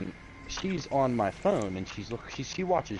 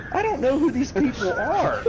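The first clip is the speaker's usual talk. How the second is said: mocking wife; 13 semitones higher, 8 dB louder than usual. Wife quoted, high mock voice.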